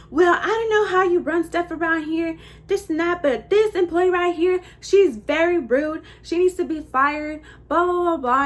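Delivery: high, loud imitation of an irate customer; mock indignant complaint. Customer demanding the worker be fired, loud mock indignation. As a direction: lay into the employee, loudly and emphatically.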